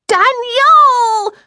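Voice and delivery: long, very high, loud shriek; piercing drawn-out child's scream; little girl's shriek. A narrator's reading. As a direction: shriek for your brother to stop. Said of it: "went from yell right to shriek".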